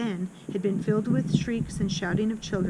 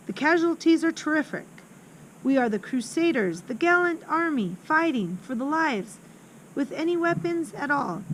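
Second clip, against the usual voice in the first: higher, an eager schoolboy rallying his troops; boy Horatius, fired up; 6 semitones higher, 4 dB louder than usual. Acted. Older boy warning new girls about the headmistress, higher and rousing. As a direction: pitch up and declaim it like a little general. Horatius describes the war, voiced.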